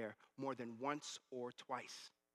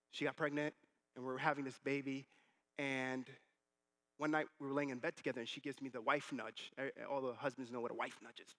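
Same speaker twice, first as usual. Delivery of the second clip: ordinary talk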